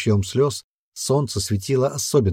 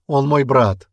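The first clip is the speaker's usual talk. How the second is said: higher, louder, insistent; protesting cry; 5 semitones higher, 5 dB louder, slower than usual. A narrator's reading. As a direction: cry out in the brother's defence. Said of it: "закричал Ули".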